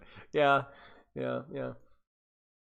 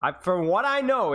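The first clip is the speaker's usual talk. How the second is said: usual speaking voice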